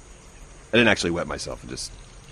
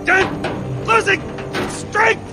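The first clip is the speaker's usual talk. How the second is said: straining; much slower than usual. Acted. Hanging on, straining.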